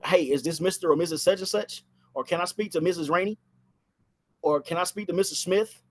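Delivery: ordinary talk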